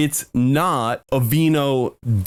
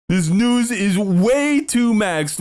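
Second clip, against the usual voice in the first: slow drawn-out mockery, stress on way; mocking drawl; 6 semitones higher, slower than usual. Real, not acted. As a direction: lean on way, drawn out. Mocking the news.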